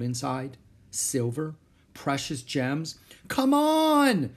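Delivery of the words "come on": very high, loud drawn-out yell; urgent shout. A narrator's reading. Girl from treehouse window, shouting. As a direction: yell for him to come. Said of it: "Annie was shouting".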